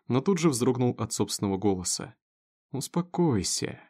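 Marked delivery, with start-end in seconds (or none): 2.7-3.8 slow, drawn-out soothing word; conciliatory. A narrator's reading. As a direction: stretch it out to calm him. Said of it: "примирительно протянул его отец". (примирительно, протяжно)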